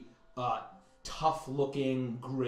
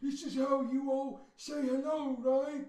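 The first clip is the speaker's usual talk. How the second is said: a big friendly brute trying to be polite; big lizard voice; 13 semitones higher than usual. Acted. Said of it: "extends its hand to kind of awkwardly".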